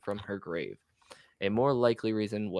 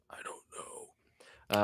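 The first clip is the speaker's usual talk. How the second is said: whispering; 10 dB quieter, much slower than usual. Real, not acted. Unsure about a word.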